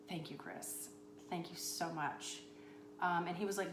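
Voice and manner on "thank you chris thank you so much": soft thanks. Retold or imitated. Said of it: "And I was like".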